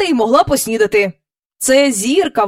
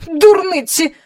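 sharp, raised royal outburst; angry; 8 semitones higher, 5 dB louder than usual. A narrator's reading. King dismisses the cow's excuse, angrily. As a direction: burst out angrily, higher and louder. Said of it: "розсердився король".

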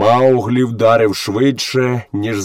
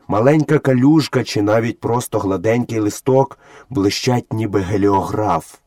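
ordinary delivery, nothing marked

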